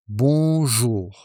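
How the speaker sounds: "Bonjour" drawn out in two even syllables; pronunciation demo. Shown as a demonstration. Teacher showing learners the greeting, careful pronunciation demo. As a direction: model "Bonjour" slowly for a learner. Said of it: "Say it like this".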